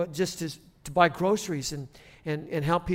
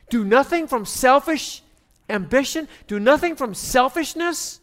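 loud, high, impassioned sermon voice; emphatic, raised pulpit proclamation; full-force preaching; 6 semitones higher, 8 dB louder than usual. Real, not acted. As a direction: drive each phrase home to the congregation.

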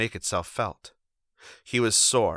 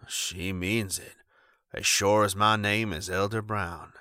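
put-on thick drunk voice. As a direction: mumble it thickly, half-drunk. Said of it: "he said thickly".

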